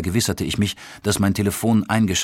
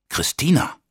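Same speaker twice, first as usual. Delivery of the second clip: startled, higher rising question; involuntary outcry; 7 semitones higher than usual. A narrator's reading. Gunnar hears his kidnapped relative called, startled outcry. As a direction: blurt the name out, pitched up in shock. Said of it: "schrie ich unwillkürlich auf".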